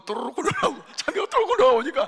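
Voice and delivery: giggling fast and loud; laughing. Real, not acted. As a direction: laugh through it as the melons roll out. Laughing at the melons rolling out.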